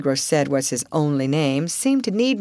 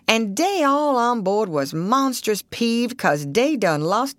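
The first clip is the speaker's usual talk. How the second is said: higher, folksy dialect voice; old Southern boatman, chatty; 5 semitones higher than usual. Acted.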